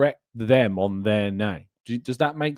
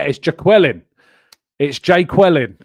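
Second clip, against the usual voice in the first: louder teacher-style "JAY-kwuh-lin"; sketch quote; 4 semitones higher, 9 dB louder than usual. Retold or imitated. Quoting the teacher calling Jaqueline, louder.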